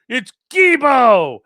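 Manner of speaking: slow, high, loud whining; mock whiny insisting. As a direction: insist like a pouty kid. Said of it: "He's like".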